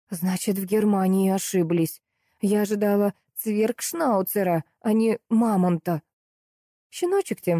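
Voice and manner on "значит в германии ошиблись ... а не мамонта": plain reading voice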